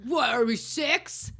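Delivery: hammed-up ribbing; mocking tease. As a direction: drag it out like mocking a kid. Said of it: "everyone's like".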